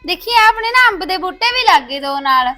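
put-on girl shouting to her stepmother about the mango saplings, loud and eager; excited calling out. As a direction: shout the news happily across the house.

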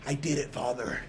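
soft, breathy mock anime plea; dramatic hushed plea. Gamer hamming up an anime son's hushed words to his father. Quoting dying Gohan scene.

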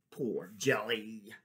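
ordinary delivery, nothing marked

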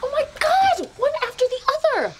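high, fast, thrilled; excited outburst. Acted. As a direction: gush it out fast.

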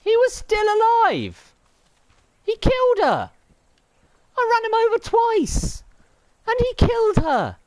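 over-the-top theatrical despair; hammed-up outrage. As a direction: play it like a shocked melodrama hero. Hamming up outrage over death.

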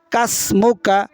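normal talking voice

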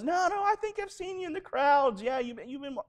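put-on high-pitched little girl voice. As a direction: pipe up like an insistent child. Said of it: "a little girl says".

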